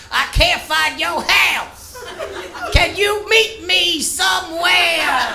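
high, slow and spaced out; stoned on the phone. Retold or imitated. Pizza guy calling the customer while high, slow and spacey. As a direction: drift through it like you're baked and lost. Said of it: "high as shit".

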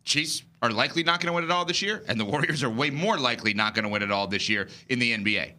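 usual speaking voice

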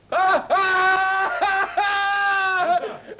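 high howling mock sob; hammed-up loud sobbing wail. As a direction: wail like an abandoned child. Fake-crying as left alone.

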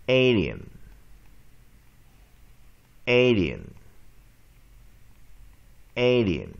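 "Alien" loud, with long A stressed and falling; isolated model of "Alien", leaning on the long A; practice model. Shown as a demonstration. Teacher practising two words with students, long A in "Alien". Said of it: "我们再把这两个单词再练习一遍".

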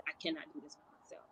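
a quiet, defeated admission; hushed plea. Retold or imitated. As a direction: say it as if barely able to speak. Pleading with husband, overwhelmed.

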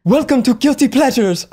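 high, badly faked Gal Gadot accent; botched Gal Gadot accent. Host redoing the intro like Gal Gadot, botched accent. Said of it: "I tried to do her accent".

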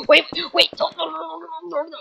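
high-pitched stream of begging; panicked pleading. Acted. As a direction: beg frantically for them to stay. Begging not to be left.

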